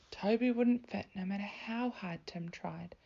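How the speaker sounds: ordinary delivery, nothing marked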